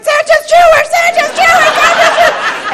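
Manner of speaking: very high mock-child shrieking; frantic repeating. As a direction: squeal it over and over like an overwhelmed kid. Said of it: "I just kept repeating it".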